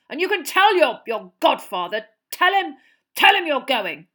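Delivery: loud grudging fury; angry. A narrator's reading. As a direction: spit out the grudging yes. Vernon gives in, furious.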